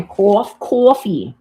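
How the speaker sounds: "coffee" with a broad New York "caw" vowel. Shown as a demonstration. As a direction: say "coffee" like a New Yorker, "cawfee". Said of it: "as we would say in New York".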